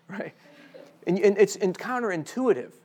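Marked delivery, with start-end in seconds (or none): none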